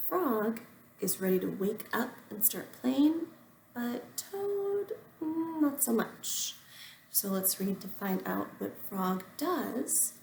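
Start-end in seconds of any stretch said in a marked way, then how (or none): 3.7-6.5 a lightly voiced drowsy toad; sleepy Toad. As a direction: drag it out like a toad who won't wake.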